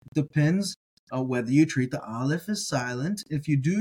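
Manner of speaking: ordinary talk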